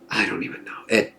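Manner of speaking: breathy whispered throwaway; whispered aside. Real, not acted. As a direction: drop to a whisper. Trailing aside, unvoiced.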